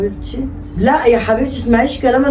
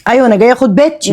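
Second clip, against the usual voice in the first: louder insistence; 3 semitones higher, 6 dB louder, faster than usual. Acted. Repeats demand louder.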